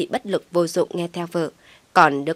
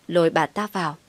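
plain reading voice

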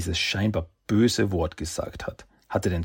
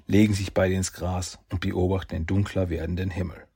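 ordinary delivery, nothing marked

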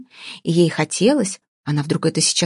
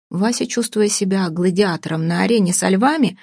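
usual speaking voice

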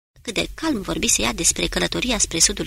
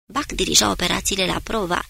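usual speaking voice

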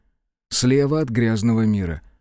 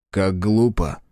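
scornful remark; 4 semitones lower, slower than usual. Acted. Azar mocks the monster.

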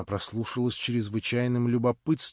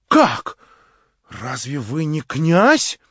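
much higher, louder, proud; Marya, defiant; 5 semitones higher, 10 dB louder, much slower than usual. A narrator's reading. Marya scorns her would-be prince, high and loud. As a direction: cry it out with haughty scorn.

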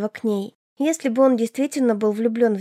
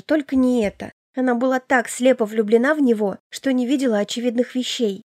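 usual speaking voice

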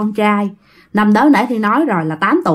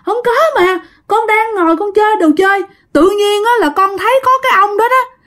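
put-on high-pitched, upset little boy; acted whiny child's voice, much higher; crying child; 10 semitones higher than usual. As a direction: plead and explain like a hurt, frightened child. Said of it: "Thằng nhỏ nó vừa khóc nó vừa nói".

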